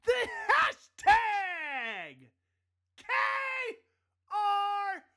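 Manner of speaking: slow, high, stretched announcer shout; hyped shout. Acted. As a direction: belt the show name like a hype man. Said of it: "show sign-off shout".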